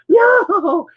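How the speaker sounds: high loud yowl; desperate meow. Retold or imitated. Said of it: "getting more and more desperate".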